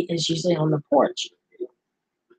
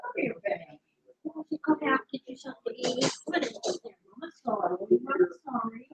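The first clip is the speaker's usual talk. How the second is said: hushed, higher soothing of a pet; soft pet talk; 7 semitones higher, 12 dB quieter, faster than usual. Real, not acted. Owner quietly apologizing to her pet, soft high cooing. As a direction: gently coo apologies to the animal.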